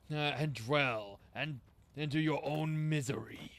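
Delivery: low, slow booming villain; Scorponok voice. Acted. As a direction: boom it out like a demon lord. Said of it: "I could be his headmaster".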